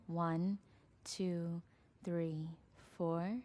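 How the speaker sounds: slow, soft breathing count with long pauses; guided meditation. Acted. Leads counted belly breaths.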